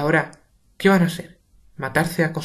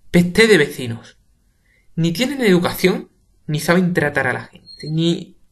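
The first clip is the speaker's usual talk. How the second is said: grumbling; 4 semitones higher, 7 dB louder than usual. Acted. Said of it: "se va murmurando".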